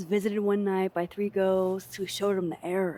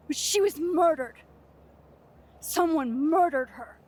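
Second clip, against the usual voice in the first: higher-pitched, upset insistence, stressing murdered; anguished protest; 5 semitones higher than usual. Acted. Student breaking up a tribute to a murdered friend, anguished protest. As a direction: protest with rising distress.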